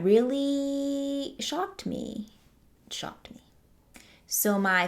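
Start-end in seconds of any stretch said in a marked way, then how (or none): 0.0-2.3 much higher and very slow, stretched out; dramatic slow. Real, not acted. Dramatic emphasis on being shocked. 2.9-3.4 a breathy whispered echo. Real, not acted. Repeating her shock to viewers as a whispered aside.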